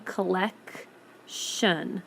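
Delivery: very slow "collection", hard C, pause, then "shun"; "collection" pulled apart to show the hard C; split before shun. Shown as a demonstration. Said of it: "You hear the hard C".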